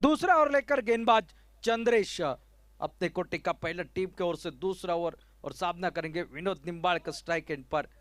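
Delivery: usual speaking voice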